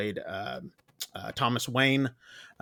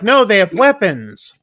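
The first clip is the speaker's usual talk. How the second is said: scared insistence; 6 semitones higher, 9 dB louder than usual. Retold or imitated. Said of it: "is getting scared".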